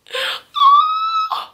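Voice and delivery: a sudden squealing gasp of delight; high delighted gasp. Real, not acted. Opening a fan card, she gasps high with delight. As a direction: gasp high at a cute bear.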